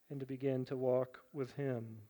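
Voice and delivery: normal talking voice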